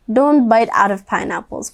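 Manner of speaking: usual speaking voice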